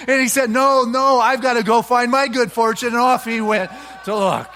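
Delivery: loud, high preaching, building as the tale runs on; fiery sermon storytelling. Acted. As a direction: preach the parable at full force to the congregation.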